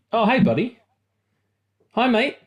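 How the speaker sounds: high, sweet coo to the cat; cat greeting. Real, not acted.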